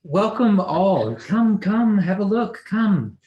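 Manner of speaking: high, slow, loud welcome; mother showing guests the hall; grand hostess. Acted. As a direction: sweep them in grandly.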